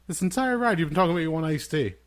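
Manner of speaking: loud, high-pitched incredulous protest; exasperated dad. Retold or imitated. Dad retells son switching from iced tea, exasperated. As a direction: protest in mock exasperation, voice jumping up.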